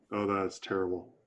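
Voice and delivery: ordinary delivery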